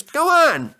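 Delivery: eager, impatient urging. Acted. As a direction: James urging Edward to hurry. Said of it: "urged James".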